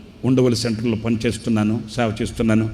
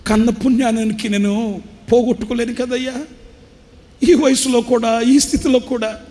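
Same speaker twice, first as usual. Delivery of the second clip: put-on crying old father; 10 semitones higher than usual. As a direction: lament it like a broken old man near tears. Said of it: "ఆ తండ్రి ఏడుస్తూ అంటాడు".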